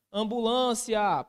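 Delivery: "Ambulância" slow, high and loud, stressing LÂN; stressed syllable demo. Shown as a demonstration. Teacher showing the penultimate stress, "Ambulância" stretched loudly with weight on LÂN. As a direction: call out am-bu-LÂN-cia slowly, leaning on LÂN. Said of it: "penúltima posição".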